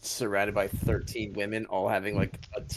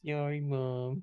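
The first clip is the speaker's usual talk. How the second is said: softer son voice; 3 semitones higher, 3 dB quieter, slower than usual. Acted. Son answering his mother.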